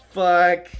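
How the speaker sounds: loud, high, drawn out after laughing; helpless exclamation. Real, not acted. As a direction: cry it out, overcome with amusement. Recovering from laughing fit.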